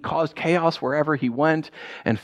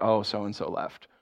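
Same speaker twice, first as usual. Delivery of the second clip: low, slow, soft retold gloom; mock sadness; 8 semitones lower, 8 dB quieter than usual. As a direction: break sad news quietly, imitating mourners. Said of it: "people were all sad".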